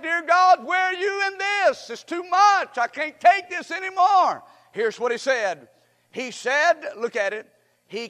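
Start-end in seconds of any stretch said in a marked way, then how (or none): 0.0-4.4 high, loud cry to God; acted-out desperate prayer; anguished plea. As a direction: plead at the top of your register, fed up. Voicing a despairing prayer to God.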